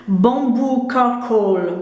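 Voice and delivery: put-on slow, drawn-out English accent on the product name; mock English pronunciation of Bamboo Charcoal. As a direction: say the brand like an English speaker, slowly. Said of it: "avec mon accent".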